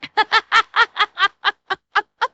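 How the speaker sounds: high, loud run of laughter; laughing. Real, not acted. Streamer cracking up at being stuck in the accent, laughing.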